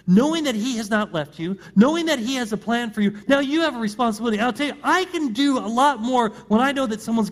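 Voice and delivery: rally the congregation with rising force; rising preaching. Acted.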